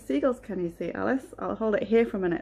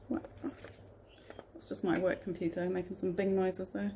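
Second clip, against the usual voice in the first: ordinary delivery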